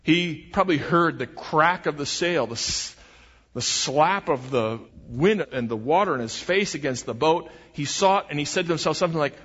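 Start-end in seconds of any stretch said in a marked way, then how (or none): none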